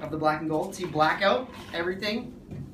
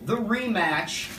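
show host intro; 5 semitones higher, much slower than usual. Acted.

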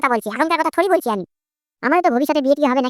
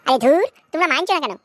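ordinary talk

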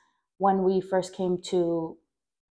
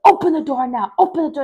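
higher, louder mock command; retold furious order, pitched up; demanding yell; 6 semitones higher, 7 dB louder than usual. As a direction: imitate a mother yelling through a door. Mother yelling at a locked door.

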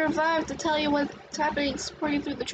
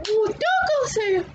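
teasing doll; 6 semitones higher, 4 dB louder, slower than usual. Acted. Doll teases the frightened group.

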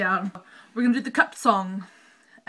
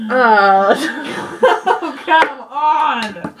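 loud laughing groan; exasperated laugh; real laughing frustration; 2 semitones higher, 8 dB louder, much slower than usual. Real, not acted.

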